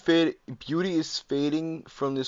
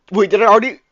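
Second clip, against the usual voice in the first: sudden loud, high alarm; startled; 8 semitones higher, 15 dB louder, much faster than usual. Real, not acted. Streamer startled while clicking through the game, loud alarmed yelp. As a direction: caught off guard, jump in panic.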